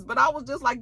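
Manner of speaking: normal talking voice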